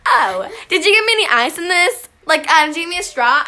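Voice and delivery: put-on loud, blunt American accent; mock plain American English, no British; regular American English. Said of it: "she just fucking speaks regular English".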